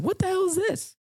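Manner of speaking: high astonished squawk; mock shock. Finding the porn section.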